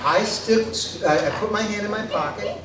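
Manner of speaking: ordinary delivery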